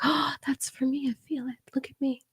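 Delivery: high, hushed whisper of a gullible believer; breathy mock excitement. Mocking herself reading a tarot comment, whispered high. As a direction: whisper it like a giddy fan of tarot.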